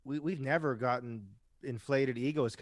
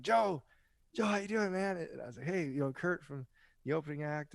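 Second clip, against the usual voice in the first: higher-pitched friendly hail to someone passing by; eager retold greeting, not shouted; calling out to a star; 4 semitones higher than usual. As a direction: re-enact catching his attention, pitched up. Retells calling out to rock star.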